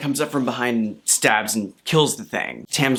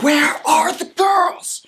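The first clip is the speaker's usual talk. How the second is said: put-on high, slow, louder desperate cry of where are the girls; drawn-out panicked yell of "girls"; frantic demand; 4 dB louder, slower than usual. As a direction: shout it out like a panicked brother. Mat demands again, frantic.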